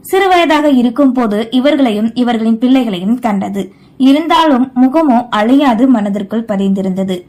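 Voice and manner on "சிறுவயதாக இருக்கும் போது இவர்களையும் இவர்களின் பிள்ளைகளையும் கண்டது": ordinary delivery, nothing marked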